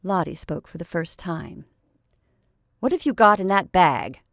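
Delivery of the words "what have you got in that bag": Lottie's louder, sharp demand; sharp question. Acted. Lottie sharply questions the ragged girl, loud and sharp. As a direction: snap the question at the stranger girl. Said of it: "said she rather sharply".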